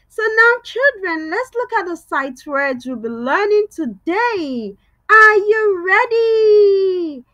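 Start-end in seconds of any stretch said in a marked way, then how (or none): 5.1-7.2 high, loud, drawn-out call to the class; rally the kids with a big voice; big energetic call. Shown as a demonstration. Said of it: "Let me hear your big voice".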